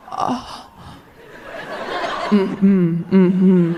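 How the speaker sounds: breathy "Oh" then two resigned hums; grim realization. Retold or imitated. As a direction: realize the bad news and nod it off with hums. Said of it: "The only time I've ever been like".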